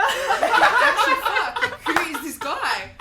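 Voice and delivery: giggling disbelief, slow and loud; laughing. Real, not acted. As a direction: crack up while you say it. Laughing while retelling awkward hug.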